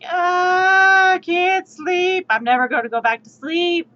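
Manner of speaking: loud, high, drawn-out groan of frustration; inner frustrated voice. Retold or imitated. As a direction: whine that you can't sleep. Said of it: "my brain was just like".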